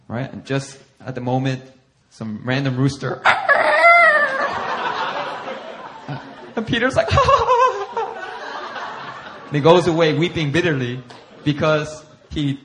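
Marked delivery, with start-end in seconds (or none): 3.3-6.5 high, bursting laugh at his own story; big laugh. Real, not acted. Laughs at the random rooster. 7.2-9.4 high, bursting laugh at his own story; big laugh. Real, not acted. Laughs again mid-story.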